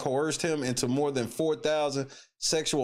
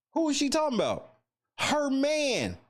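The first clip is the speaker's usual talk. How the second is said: pitched way up, teasing; mock high voice; 12 semitones higher than usual. Mocking her, answers his own question in a high voice. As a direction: squeak out the answer in a silly high voice.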